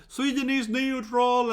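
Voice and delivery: high, loud, slow mock shriek; retold scream. Imitating the classmate screaming at the weapons students, slow mock shriek. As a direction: drag it out like a hysterical protester. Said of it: "She started screaming at them".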